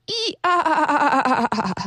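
drawn-out braying donkey hee-haw. Acted. Donkey brays his anger over Jerusalem, slow and drawn out. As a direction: stretch the I-A like a donkey crying out.

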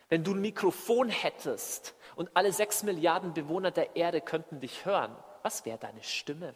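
ordinary delivery

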